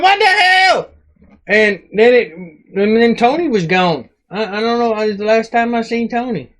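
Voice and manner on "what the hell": very high, mock-alarmed outcry; retold escalating shock. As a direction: push the astonishment way up high. Bigger reaction after it grew.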